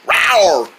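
short "rawr" that falls away; a quick playful dinosaur rawr; pretend dinosaur roar. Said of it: "bears don't roar like that".

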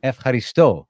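learner echoing F, haristo with a strong STO; stress on last syllable. Shown as a demonstration. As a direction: repeat F, haristo, accenting the final sto. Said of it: "the accent's on the last syllable".

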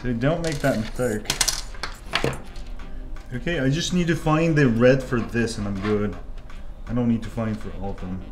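ordinary talk

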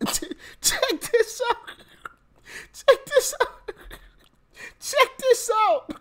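very high squeaky laughing tease; giggly teaser. Real, not acted. Teasing reveal, laughing.

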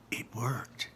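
soft awed whisper; whispered awe. A narrator's reading. Narrator whispering as the bridge appears. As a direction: breathe it in wonder.

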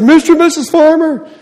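high yelled call from upstairs. Retold or imitated. Kids yelling for the parents upstairs, high call. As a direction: shout up for the parents.

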